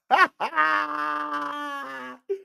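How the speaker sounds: a high, crying squeal of a laugh. Real, not acted. Host cracks up at her own joke, squealing laugh. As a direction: burst out in a squealing cackle.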